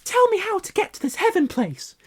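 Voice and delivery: put-on very high child voice; a boy begging earnestly; wistful plea. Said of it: "Harry cried wistfully".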